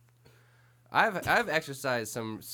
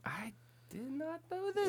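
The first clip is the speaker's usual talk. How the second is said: mock surprise; 5 dB quieter than usual. Real, not acted. Surprised at new movie.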